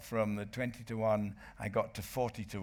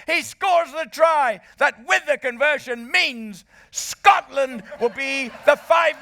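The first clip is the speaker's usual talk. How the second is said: ecstatic screaming commentary, far higher and louder, building; commentary yell; 15 dB louder than usual. Retold or imitated. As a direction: bellow the try like a wild fan. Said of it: "and I said".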